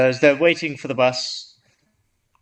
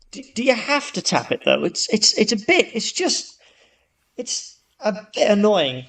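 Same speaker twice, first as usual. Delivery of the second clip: higher, a touch irritated and hesitant; politely complain, getting fed up; mildly annoyed; 5 semitones higher than usual. Acted. Annoyed man in joke.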